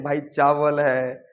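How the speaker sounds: each word drawn out, very slow; proud slow listing. Retold or imitated. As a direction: savour every name, slowly. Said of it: "उससे अच्छा तो हम लोग बोलते हैं".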